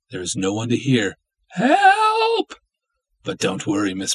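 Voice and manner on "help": very high, piercing cry for help; desperate scream. Acted. Captive woman screaming for help, very high. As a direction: scream it to the rafters. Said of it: "Scream all you want".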